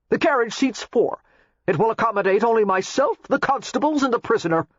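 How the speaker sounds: lightly voiced stiff official; haughty Waring. A narrator's reading. Waring refuses.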